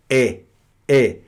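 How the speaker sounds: "é" with an open vowel. Shown as a demonstration. Teacher showing students the Portuguese accented e, open vowel. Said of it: "nós pronunciamos o som aberto".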